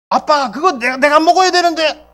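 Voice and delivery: put-on a kid protesting his food is taken; child whining to father about his bread; whiny child.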